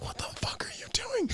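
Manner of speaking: high urgent whisper; whispered parental alarm; whispered scold. Retold or imitated. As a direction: hiss it like a shocked dad. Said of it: "the dad's like".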